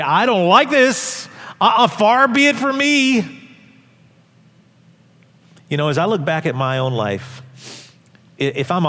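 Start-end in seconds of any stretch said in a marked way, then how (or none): 0.0-3.4 louder, higher, slow stubborn protest; mock refusal. Believer resisting God's direction, stubborn mock refusal. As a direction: act out a sulky believer saying no. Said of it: "we're like".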